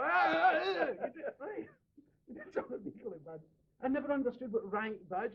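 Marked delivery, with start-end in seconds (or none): 0.0-0.8 mock laugh.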